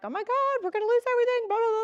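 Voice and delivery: freaking out. Retold or imitated. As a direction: retell the panic at full pitch. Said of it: "freaking out".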